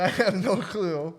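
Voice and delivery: slow, clueless mock innocence; hammed-up cluelessness; playing dumb. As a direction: drag it out like you know nothing. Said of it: "I played the dumbass card".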